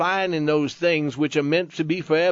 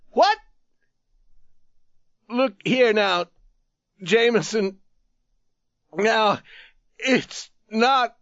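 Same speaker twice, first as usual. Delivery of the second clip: higher, raised calling plea; begging; 7 semitones higher, 3 dB louder, slower than usual. A narrator's reading. Tattered man calls after the leaving youth, begging. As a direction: beg him to come back. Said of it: "He could hear the man begging".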